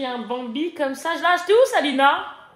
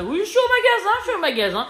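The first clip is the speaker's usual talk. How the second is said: loud and high; 11 semitones higher, 7 dB louder, faster than usual. Retold or imitated. Answering husband on phone.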